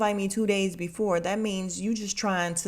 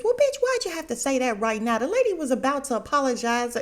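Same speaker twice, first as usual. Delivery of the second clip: high-pitched imitated outrage; exasperated telling-off, hammed up for laughs; mock scolding; 7 semitones higher, 4 dB louder than usual. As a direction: scold her in comic disbelief. Imitates Quad scolding Heavenly for interrupting.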